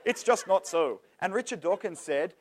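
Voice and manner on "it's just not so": put-on Scottish accent. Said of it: "He's got a Scottish accent".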